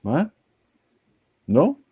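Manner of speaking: ordinary delivery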